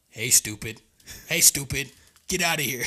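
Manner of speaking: loud, spaced-out calls; retold yelling. Hunter shooing a stubborn buck, retold yelling. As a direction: re-enact shooing an animal away. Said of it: "I just kept yelling".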